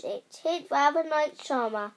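ordinary delivery

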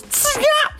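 a sharp high shush to silence someone; shushing hiss. Retold or imitated. Woman on temple floor shushing the sneezer, sharp hiss. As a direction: shush the noisy visitor fast.

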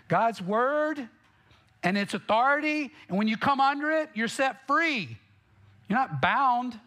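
impassioned pulpit peak; fervent preaching. Real, not acted. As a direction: pitch climbs high as he presses the point. Preacher rising to a climax.

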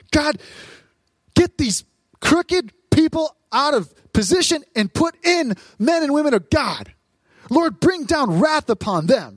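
high, forceful mock plea for wrath; angry prayer. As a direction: call down judgment in hammed-up indignation. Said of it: "We're praying these prayers".

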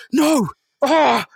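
high, loud anguished scream. Retold or imitated. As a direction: cry out in despair. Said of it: "He screams".

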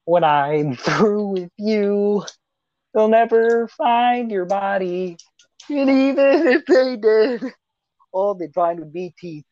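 high, slow, gloating villain voice with a laugh; game villain threat. Retold or imitated. Friend reciting the game villain's threat to the player, slow and gloating. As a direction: savour each threat like a cartoon killer. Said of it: "He just imitates the game".

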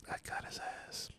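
whispered imitation of a gloating customer; barely audible mock triumph; secret gloating. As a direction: whisper it to yourself, victorious. Customer privately gloating on the phone.

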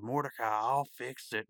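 Rigby. Retold or imitated. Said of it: "he gets really big".

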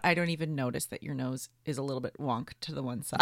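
usual speaking voice

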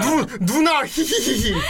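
lightly cutesy, giggling; a hint of acting cute. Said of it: "어디 귀여운 척을 하고 있어".

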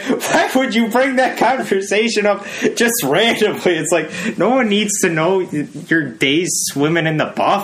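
high-pitched, incredulous scolding; mock disbelief. Podcaster chiding the oversharing announcer, mock incredulity.

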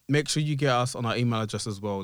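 usual speaking voice

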